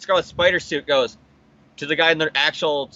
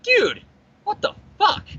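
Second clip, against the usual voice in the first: high, squeaky mock disbelief; hammed-up falsetto bewilderment; incredulous reaction. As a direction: yelp dude, pause, then squeak the protest. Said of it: "if it was real life, he'd be like".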